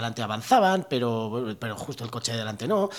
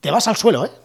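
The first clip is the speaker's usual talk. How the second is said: threatening cop; 8 semitones higher, 7 dB louder than usual. Retold or imitated. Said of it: "Y me dijo".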